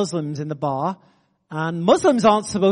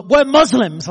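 louder mock challenge; imitated confrontation; 3 semitones higher, 8 dB louder, slower than usual. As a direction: throw it out like a retold challenge. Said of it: "like that".

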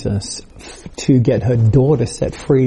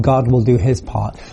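normal talking voice

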